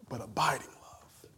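hushed whisper of the key words; a whispered abiding love. Acted. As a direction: breathe it out softly. Preacher whispers key phrase.

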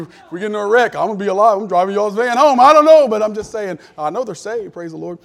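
preacher joking loudly to the congregation; loud playful boast. Real, not acted. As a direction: crow it to the room for a laugh.

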